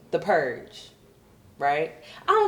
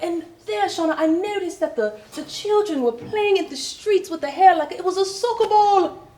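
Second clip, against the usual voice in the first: mock British woman, pitched up; British coworker; 12 semitones higher, 4 dB louder than usual.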